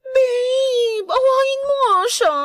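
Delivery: whiny crying plea. Acted. Glenda begs babe to fight.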